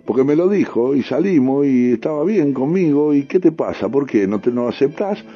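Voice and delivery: insistent, mildly indignant comeback; defiant retort. Acted. Acted retort about an affair.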